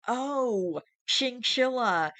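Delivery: high, loud and drawn-out oh of discovery; a loud slow aha at the answer; sudden realization. Real, not acted. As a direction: it finally clicks, call it out. Sudden realization about the eye drops.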